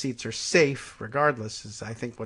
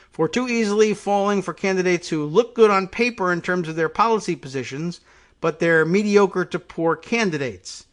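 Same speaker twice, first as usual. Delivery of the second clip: higher-pitched news reading, punched out; emphatic article read-aloud; 6 semitones higher, 8 dB louder than usual. Real, not acted.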